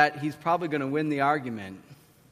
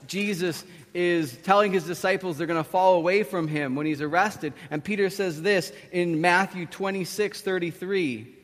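normal talking voice

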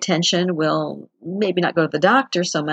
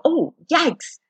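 startled yikes; 9 semitones higher than usual. Retold or imitated. Sudden neck pain while driving.

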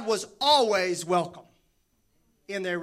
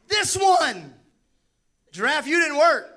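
louder, higher, thrilled; excited exclaim; 4 semitones higher, 7 dB louder than usual. Pretend. Man exclaiming as he first sees woman. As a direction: burst out at the sight of her. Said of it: "the man exclaimed".

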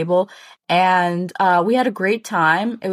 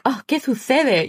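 a hint of a lisping Spain Spanish accent, a bit higher; lightly mock a Castilian lisp; put-on Spanish accent; 6 semitones higher than usual. Friend faking a Spanish accent in line at the club. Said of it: "we were putting on this accent".

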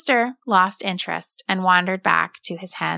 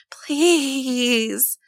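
put-on boy on the gate begs the rooster, terrified and higher; a frightened, strained higher please; 5 semitones higher than usual. A narrator's reading. Begging the rooster in terror. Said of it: "He let out a terrified".

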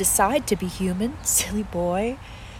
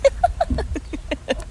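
breathy laugh; 12 semitones higher than usual. Acted. Tree-person amused by his answer.

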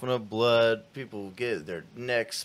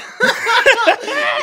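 high laugh; 11 dB louder than usual. Real, not acted. Laughing at own impression.

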